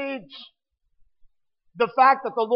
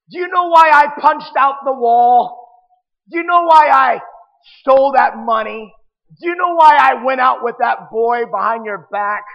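angry defiance; 9 dB louder than usual. Pretend. Preacher acting an angry person arguing, loud shout. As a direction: yell it like an angry teen justifying. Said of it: "Angry people argue".

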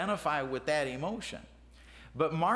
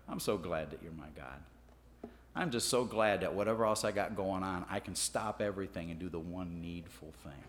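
tender; 6 semitones lower, 4 dB quieter than usual. Retold or imitated. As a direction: murmur it like heartfelt thanks. Devoted prayerful words to God.

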